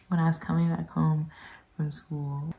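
usual speaking voice

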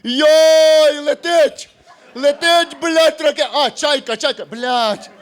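high, loud panicked yelling, fading; mock panic. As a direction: yell like a spotter losing it. Уявний вояк ППО панікує на пагорбі. (панічний крик, високо й гучно)

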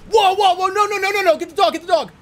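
high, loud alarmed yelling; retelling a dream where people yell about a dog; retold panicked shout. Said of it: "And the whole house was like".

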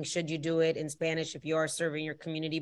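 usual speaking voice

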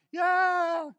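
high, loud yeah like a cheering fan; cheering crowd voice. Retold or imitated. Crowd greeting Jesus on the donkey, a loud high cheer. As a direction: shout it up like a crowd hailing a king.

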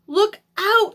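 high, loud warning cry; warning shout. A narrator's reading. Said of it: "someone shouted".

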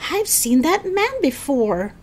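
high, alarmed realization; gasped recognition. A narrator's reading. Howie recognizes the new bus driver, gasping. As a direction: raise the pitch in hushed alarm. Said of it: "Howie gasped".

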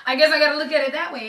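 loud, quick, through a laugh; amused. Real, not acted. Fan reconsidering the people waiting in front, laughing.

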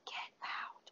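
unvoiced breathy whisper. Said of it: "that whisper of".

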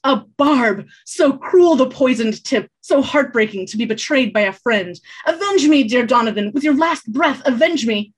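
loud, high hammed-up death cry; melodramatic dying bard. Bard faking death over a barb, melodramatic. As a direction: play a tragic stage death.

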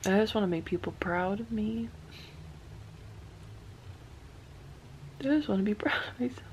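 tearful, breaking into a shaky whispered laugh; fighting back real tears; starting to cry. Real, not acted. Wanting to make others proud, then tears break through. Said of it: "I didn't mean to start crying".

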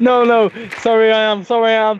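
high, loud mock apology; mock pleading. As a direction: beg loudly through a grin. Fake pleading in backwards talk.

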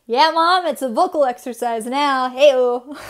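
loud, playful, triumphant retort; loud teasing. Real, not acted. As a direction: gloat at mom, loudly. Teasing mom, loud excited reply.

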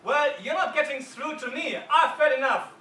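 angry juror refusing to budge, shouting; defiant shout. Retold or imitated. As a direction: slam the door on them with your voice. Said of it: "He starts shouting".

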